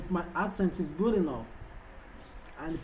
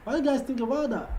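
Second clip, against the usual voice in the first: much higher, quicker question; playful repeat; 7 semitones higher, faster than usual. Pretend. Asking viewers about the foreign accent, playfully high and quick. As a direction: pitch it up high and rattle it off.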